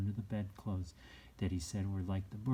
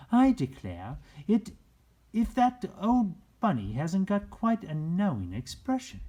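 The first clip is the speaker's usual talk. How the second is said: higher and louder, surprised nurse; amused Nana; 11 semitones higher, 8 dB louder than usual. A narrator's reading. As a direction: exclaim like a nanny noticing something odd.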